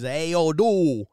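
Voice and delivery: loud imitated Cajun greeting; Cajun accent impression. Imitating a guy on Instagram, Cajun accent. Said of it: "like, a real Cajun accent is".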